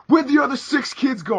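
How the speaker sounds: high mock cop demand; retold cop grilling scared kids. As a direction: question them like a pushy cop. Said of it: "and then he's like".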